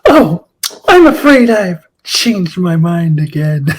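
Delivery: teasing, lilting fake apology; coy mock regret. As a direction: flip the tease with a playful sigh. Uhura turns tables on Sulu.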